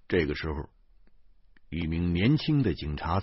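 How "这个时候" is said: usual speaking voice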